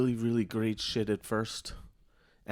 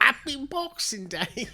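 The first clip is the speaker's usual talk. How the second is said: mock old-timey greeting; 12 semitones higher than usual. Jokey period holiday greeting.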